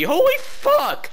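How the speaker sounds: sudden high shocked cry. Real, not acted. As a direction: blurt it out in shock.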